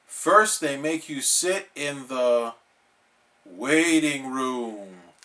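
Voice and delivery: slow, dramatic warning; ominous slow telling. Acted. Patrick scaring SpongeBob about the doctor. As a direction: draw it out like a horror tale.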